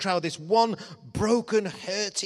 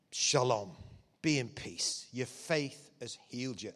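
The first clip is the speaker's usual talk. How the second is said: gentle blessing; 7 semitones lower, 8 dB quieter than usual. Retold or imitated. Jesus blesses healed woman.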